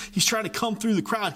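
ordinary talk, nothing marked

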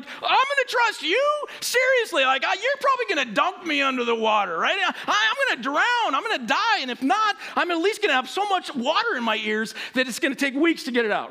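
put-on a scared kid arguing his way out of jumping; panicked protest. Boy on the high dive protesting to his instructor, high and panicked.

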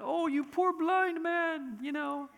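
a hint of syrupy comfort; mild teddy-bear Jesus. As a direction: play a harmless nice-guy Jesus, just a touch. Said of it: "My Jesus would have walked by and gone".

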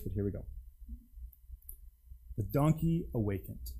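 usual speaking voice

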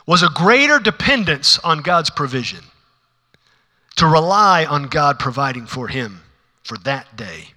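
usual speaking voice

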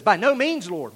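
loud, high acted objection; Peter's protest.

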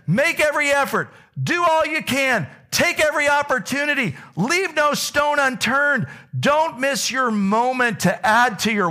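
high, ringing preacher read of the verses; preaching scripture. Acted. Pastor proclaims Peter's words to the church, preaching high. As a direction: proclaim it like a call to action. Reads scripture at full force.

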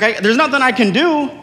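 high-pitched imitated excuse; mock helpless protest. Friend's protest in acted quarrel.

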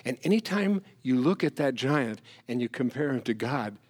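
usual speaking voice

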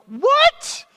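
high, loud, disbelieving yelp; shocked what. Retold or imitated. Friend hearing the arm doesn't matter, shocked high yelp. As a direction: react in total disbelief. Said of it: "You're like".

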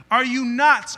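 a pastor playing Philip calling the plan nuts, loud and incredulous; incredulous outburst. Philip exclaiming in disbelief.

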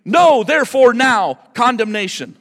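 No hit loud and high at the start; stress on No. Shown as a demonstration. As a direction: slam the word No first. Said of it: "It literally would read".

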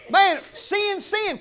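usual speaking voice

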